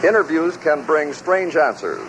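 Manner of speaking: usual speaking voice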